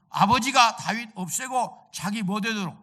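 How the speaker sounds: ordinary talk, nothing marked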